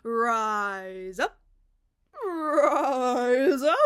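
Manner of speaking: loud, swelling mock declamation; mocking her poem. Mimicking the lady's dramatic spoken word poem, loud mock performance. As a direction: ham up the dramatic chant. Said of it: "What were the words?".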